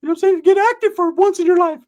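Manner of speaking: way up high and loud, cocky friend bragging; loud high cartoonish bro voice; high-pitched Ricky voice. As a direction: squeak it out like a boastful buddy. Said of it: "He's like".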